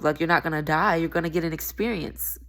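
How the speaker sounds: ordinary delivery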